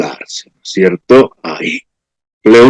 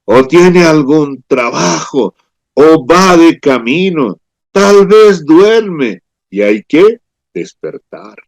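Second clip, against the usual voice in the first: slow, taunting, rising higher and higher; mocking Elijah; 5 semitones higher, slower than usual. Elijah taunting the prophets of Baal, slow sarcastic mockery. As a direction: drag it out like you're laughing at them. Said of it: "Se burlaba de ellos, diciendo".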